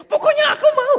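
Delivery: put-on very high, loud kid shriek; squealed, stubborn demand; child's tantrum. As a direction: throw a mock toddler tantrum. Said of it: "Teriak-teriak di mall".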